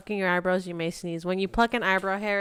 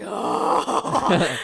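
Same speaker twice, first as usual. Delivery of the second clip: startled loud "Oh."; surprised; 10 dB louder than usual. Retold or imitated. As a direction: gasp out a startled oh. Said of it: "And I'm like".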